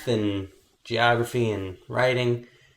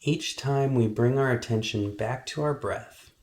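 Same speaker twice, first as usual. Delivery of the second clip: guided meditation. Acted. Meditation teacher helping kids focus on their breath, calm guiding voice. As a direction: guide them calmly through each breath, unhurried.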